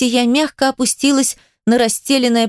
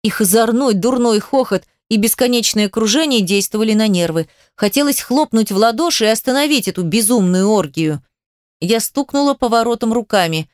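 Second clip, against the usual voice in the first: plain reading voice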